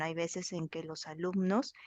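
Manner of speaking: ordinary delivery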